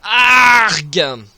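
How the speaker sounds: sudden loud cry of pain; short loud gamer yelp; pained shout. Real, not acted. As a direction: yell out as you get hit. Hit by enemy in game.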